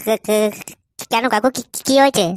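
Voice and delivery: stuttering, rising alarm; nervous stammer. Acted. Fuchka seller caught out by angry husband, stammering nervously. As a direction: trip over the first syllable in fright.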